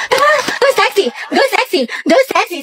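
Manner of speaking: playful chant starting high; excited crush cheer. Acted. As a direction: cheer on your crush flirtatiously. (kinikilig na pag-cheer)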